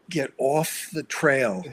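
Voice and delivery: slow, loud command to get off the trail; telling off. Retold or imitated. Telling the dirt biker to leave the horse trail, firm loud order. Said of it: "I'm going".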